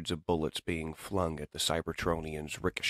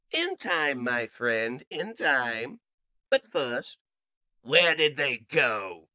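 scheming villain robot; 8 semitones higher, 3 dB louder, slower than usual. Acted. Villain answers his hurt comrade.

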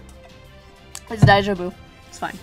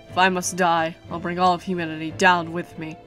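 quiet gloating villain; 3 semitones lower, 4 dB quieter than usual. Acted.

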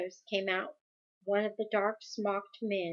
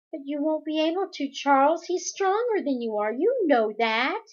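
put-on higher, insistent young Meg voice; worried girl objecting; 6 semitones higher, 6 dB louder than usual. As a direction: protest in a raised, pleading pitch, stretching the ending. Voices Meg pleading with Charles.

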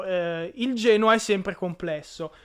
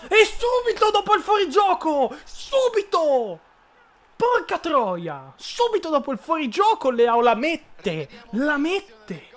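explosive italian cursing; 7 semitones higher, slower than usual. Real, not acted. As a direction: rage at the goal. Outraged at offside goal.

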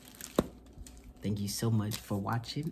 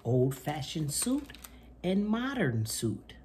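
bright, lively picture-book reading voice; reading to children; 6 semitones higher, slower than usual. Acted. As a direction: read it to little kids with gusto. Reads picture book aloud.

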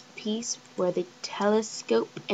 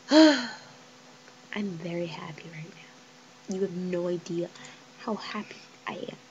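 happy whisper; 3 semitones lower, 7 dB quieter than usual. Real, not acted. Player whispering to viewers how happy she is. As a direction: hush it like a secret joy.

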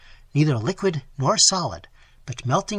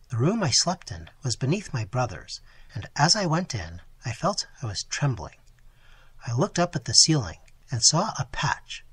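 usual speaking voice

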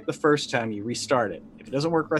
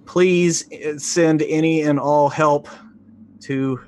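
louder, urgent radio plea for rescue; distress call; 2 semitones higher, 4 dB louder than usual. Acted. Stranded agent pleading over the radio, urgent and loud. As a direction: beg for help over the comm.

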